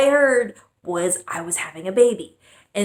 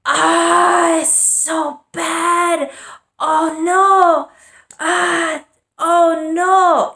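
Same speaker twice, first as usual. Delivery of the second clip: much higher and louder mock-mom cry of dismay; mom shouting in alarm; 10 semitones higher, 7 dB louder, much slower than usual. Mom reacting to the stain, loud high imitated alarm. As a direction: wail it like a panicked mom. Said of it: "And she goes".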